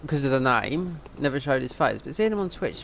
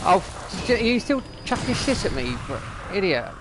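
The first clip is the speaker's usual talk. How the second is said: angry; 6 semitones higher than usual. Real, not acted. Enemy keeps hitting him.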